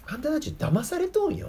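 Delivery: a hint of Jesus gently warning; gentle Jesus warning. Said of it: "って。".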